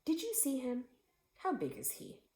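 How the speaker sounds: put-on a curious boy bursting with questions; excited child voice; eager boy. A narrator's reading. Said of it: "said my father".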